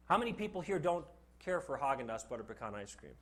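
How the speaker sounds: ordinary delivery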